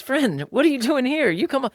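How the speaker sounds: high, loud, delighted welcome; the host warmly promoting a humble guest; welcoming host. Retold or imitated. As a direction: call the guest up to the good seat. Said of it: "the host comes to you and goes".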